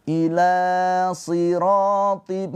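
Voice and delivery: slow melodic tajwid chanting; Quranic recitation. Acted. Teacher chants the verse for students, slow and melodic.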